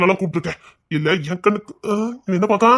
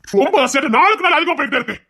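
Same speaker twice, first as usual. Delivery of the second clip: angry yelling; 6 semitones higher than usual. Acted.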